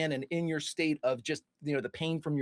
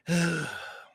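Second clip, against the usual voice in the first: weary audible sigh; human sigh; 4 semitones higher than usual. Acted. Game master as Pennywise before turning to George, human sigh. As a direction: let out a long human sigh. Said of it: "sighs and in a very human way".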